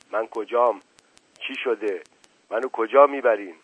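usual speaking voice

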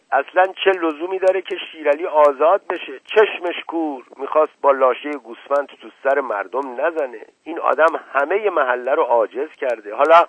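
loud, exasperated outburst; angry shouting. Acted. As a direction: shout it out in indignant anger. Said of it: "عصبانی فریاد زد". (فریاد عصبانی)

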